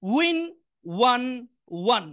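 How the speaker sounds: One one drawn out, very slow; slow drill. Shown as a demonstration. Teacher drawing out one slowly for listening students. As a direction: stretch each one for the class. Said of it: "সবাই একটু মনোযোগ দিয়ে শুনবেন".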